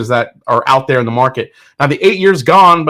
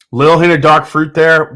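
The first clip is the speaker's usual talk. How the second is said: ordinary talk, nothing marked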